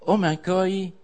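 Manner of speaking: ordinary delivery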